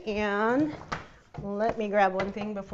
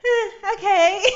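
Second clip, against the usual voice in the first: laughing reluctant okay; 12 semitones higher, 8 dB louder, slower than usual. Retold or imitated. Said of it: "I was like".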